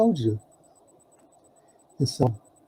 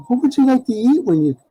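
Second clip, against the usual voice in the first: imitating Faley; 10 semitones higher than usual. Faley asks about his return.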